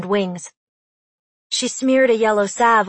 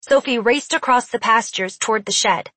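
ordinary delivery

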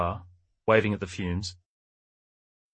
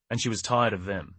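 usual speaking voice

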